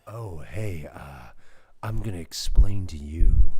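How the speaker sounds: low, loud parody of a seductive man; exaggerated low male voice, booming; mock sexy man. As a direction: ham up a deep man voice as if explaining. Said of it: "sexy man voice".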